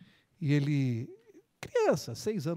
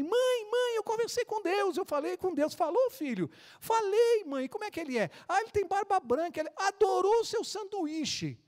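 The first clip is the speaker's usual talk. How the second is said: put-on much higher, eager child voice; thrilled little boy; 12 semitones higher than usual. Little boy running in to tell his mother he met God. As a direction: burst in bursting with news.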